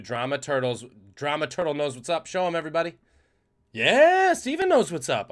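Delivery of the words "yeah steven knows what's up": big hyped shout, high and loud; excited shout. Real, not acted. Streamer hyping chat with a loud excited shout. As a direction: hype up the chat loudly.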